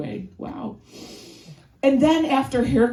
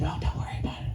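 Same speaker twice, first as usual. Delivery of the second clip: hushed mom whisper; 5 semitones lower, 9 dB quieter, much faster than usual. Retold or imitated. Mom hushing her five-year-old.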